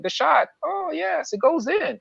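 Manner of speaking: high, overexcited play-by-play parody; mock excited commentator. Mocking a dumb commentator, high and gushing. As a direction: gush over the obvious like a clueless announcer.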